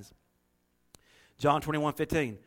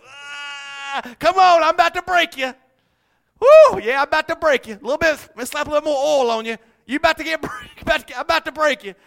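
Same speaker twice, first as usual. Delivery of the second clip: loud, high, hammed-up taunting shouts; mock tough-guy shout; 8 semitones higher, 9 dB louder than usual. Preacher acting out people bullying others, mock shouting. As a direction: bellow it like a playful bully. Mock roughhousing someone to break them.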